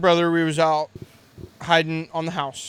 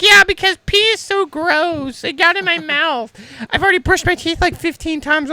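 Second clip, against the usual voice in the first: put-on whiny girl voice; 10 semitones higher, 4 dB louder than usual. Girl telling the friend pee got in her mouth, high mock whine. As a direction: imitate her disgusted whine, pitched way up. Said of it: "she was like".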